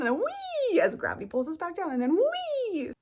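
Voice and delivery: high, loud wee, falling; gliding high wee; playful wee. Shown as a demonstration. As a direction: squeal like a kid on a trampoline. Imitating bouncing.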